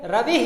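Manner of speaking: "रवि" ending in an echoed hi; visarga pronunciation. Shown as a demonstration. Teacher reading the word with final visarga, pronounced demonstration. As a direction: say "रवि" as ravi-hi. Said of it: "क्या पढ़ेंगे?".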